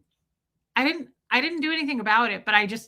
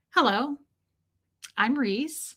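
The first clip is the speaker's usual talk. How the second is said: fake politeness; 3 semitones higher, slower than usual. Vlogger mimicking how she won't greet her viewers, fake. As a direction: put on a fake, formal hello. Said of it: "sit here and be fake".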